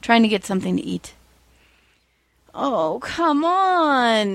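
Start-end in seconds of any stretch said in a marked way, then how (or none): 2.5-4.3 high, drawn-out whine; whining hero. A narrator's reading. Hero whining at the cat in his garbage can. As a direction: whine it out slowly like a put-upon hero. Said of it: "the hero whined".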